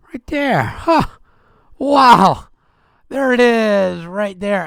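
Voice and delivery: high, loud pleased surprise; surprised. Real, not acted. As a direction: sound delighted to find it. Surprised finding link.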